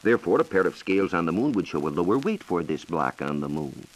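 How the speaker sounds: ordinary delivery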